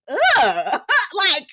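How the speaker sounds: high, laughing "ugh"; laughing disbelief. Real, not acted. Reacts laughing to rude remark.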